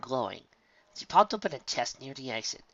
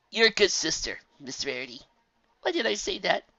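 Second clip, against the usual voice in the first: colt voice; 8 semitones higher, 3 dB louder than usual. A narrator's reading. Colt compliments Rarity.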